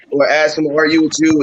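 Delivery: ordinary delivery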